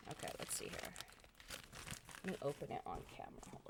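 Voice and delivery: whispered aside. Real, not acted. Quiet aside while unboxing.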